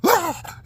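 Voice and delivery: loud, very high panicked shriek; sudden high yelp of fright; terrified scream. Retold or imitated. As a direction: shriek like you just saw a giant spider. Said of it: "lost my shit and said".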